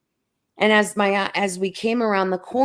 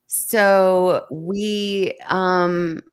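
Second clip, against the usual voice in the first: usual speaking voice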